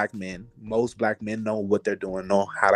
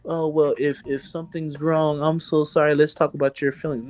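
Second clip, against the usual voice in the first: gentle pitying tone, lightly mocking; sweet sympathetic voice, lightly put on; soft coddling voice; 4 semitones higher than usual. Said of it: "They're not gonna say".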